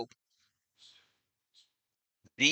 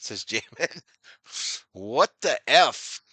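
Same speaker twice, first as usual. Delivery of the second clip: ordinary delivery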